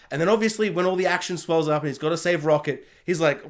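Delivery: normal talking voice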